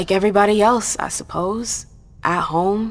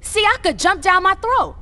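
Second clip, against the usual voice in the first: high, loud and indignant; exasperated loud protest; indignant outburst; 12 semitones higher, 5 dB louder than usual. Acted. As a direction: fire it back in outrage. Heated argument with professor.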